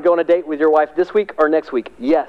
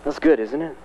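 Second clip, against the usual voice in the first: ordinary delivery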